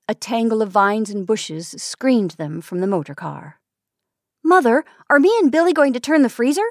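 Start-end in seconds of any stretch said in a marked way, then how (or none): 4.4-6.7 put-on high, quick, loud little child asking; child's voice. Small boy asks his mother about the freezer, high and eager. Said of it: "said a child's voice".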